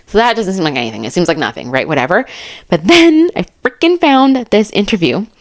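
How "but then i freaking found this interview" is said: excited. Real, not acted. Excited reveal of new evidence.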